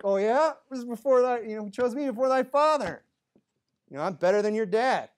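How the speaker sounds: high, loud taunting retort; acted one-upping comeback; firing back. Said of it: "he just fires right back".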